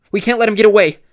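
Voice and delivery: high, loud urgent yell; rally him to chase. A narrator's reading. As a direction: Brenda yelling during the fight. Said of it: "Brenda yelled".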